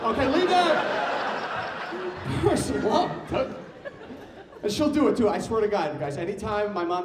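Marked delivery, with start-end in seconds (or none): 0.0-3.5 very slow, higher pleading; slow drawn-out scolding. Real, not acted. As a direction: stretch each word out, laughing. Scolding mom, stretched out.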